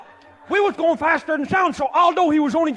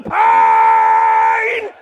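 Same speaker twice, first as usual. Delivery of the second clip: one high, loud held cry of pain; screamed word; 8 semitones higher, 7 dB louder than usual. Retold or imitated. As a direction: wail the word out like the nerves scream. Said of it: "every nerve fiber in there was screaming".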